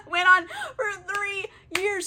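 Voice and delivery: high-pitched words through laughter; real giggly incredulity; laughing disbelief. Real, not acted. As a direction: repeat it while cracking up. Cracks up repeating the absurd detail.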